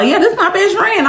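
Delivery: high bragging friend voice; quoting a friend. Retold or imitated. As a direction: show her off proudly. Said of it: "would call".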